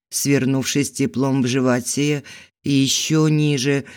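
ordinary delivery, nothing marked

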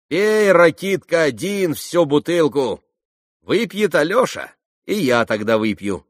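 high, loud teasing voice; tossing it off gaily; young woman siding with Alyosha, lively and high; playful Grushenka. A narrator's reading.

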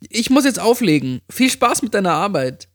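high, breezy friend signing off; Amy. A narrator's reading. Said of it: "sagte sie".

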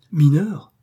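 exclamation surprise, plus haute et plus forte; s'écrier, étonné. Acted. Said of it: "s'écria le démon". (le démon s'écrie, surpris et plus fort)

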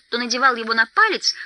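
usual speaking voice

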